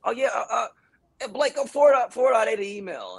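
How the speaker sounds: high-pitched, hesitant mock agent voice; offhand agent. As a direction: imitate an agent casually remembering an errand. Mimics agent's casual follow-up.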